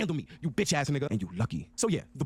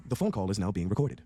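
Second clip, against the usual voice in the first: lightly imitated automated phone announcement; lower, matter-of-fact assistant voice; Siri; 6 semitones lower than usual. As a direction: read it like a recorded system notice. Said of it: "Siri goes".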